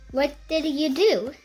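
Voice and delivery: plain reading voice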